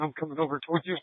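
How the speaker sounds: normal talking voice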